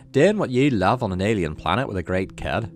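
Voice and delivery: dad hamming a mild monster voice; mock monster dad. Dad clowning for his kid, a hint of mock monster. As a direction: lightly growl it like a playful monster. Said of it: "scary impression of a monster".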